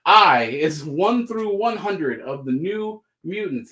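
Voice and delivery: normal talking voice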